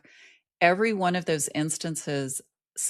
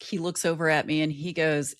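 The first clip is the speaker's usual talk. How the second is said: normal talking voice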